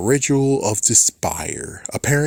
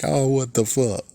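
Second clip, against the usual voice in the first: ordinary talk, nothing marked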